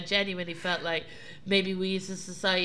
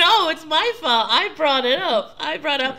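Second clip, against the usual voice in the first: laughing while nauseous; loud, high laughing apology; 7 semitones higher, 9 dB louder than usual. Real, not acted. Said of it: "I feel a bit nauseous".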